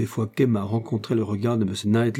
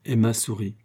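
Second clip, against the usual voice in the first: ordinary delivery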